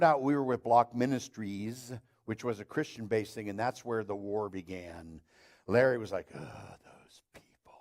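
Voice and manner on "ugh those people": hushed, much softer, disgusted mutter; mocking grumbling neighbour. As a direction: sneer it under your breath. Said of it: "he talked like this".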